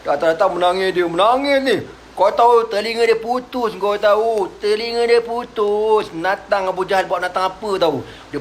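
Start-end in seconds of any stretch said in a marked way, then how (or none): none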